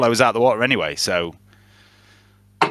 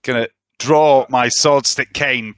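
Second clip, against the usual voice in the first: ordinary talk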